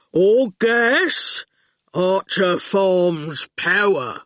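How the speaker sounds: slow, heavy menace; lumbering threat, word by word; threatening goon. A narrator's reading. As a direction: dim enforcer laying out the threat.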